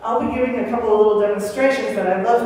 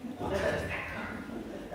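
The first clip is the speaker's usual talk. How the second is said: hushed whisper, almost breath only; whispering to her little boy; whispered question; 17 dB quieter, faster than usual. Retold or imitated. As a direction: whisper it like asking a secret. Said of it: "I started saying".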